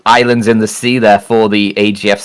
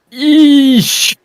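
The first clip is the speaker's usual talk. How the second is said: wince. Real, not acted. Reaction to a lopsided scoreline.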